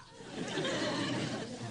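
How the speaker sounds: high, breathless laugh of delight; a laugh that spills over with joy; emotional laugh. Acted. Tutor laughing with pride at Jack, giddy and emotional. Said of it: "I'm becoming emotional".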